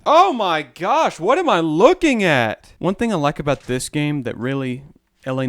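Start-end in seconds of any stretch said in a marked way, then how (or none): 0.0-2.7 startled disbelief. Real, not acted. Startled by a game image.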